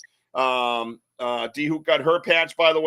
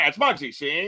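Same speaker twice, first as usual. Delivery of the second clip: Muggsy gangster. Retold or imitated. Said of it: "He was like a Muggsy".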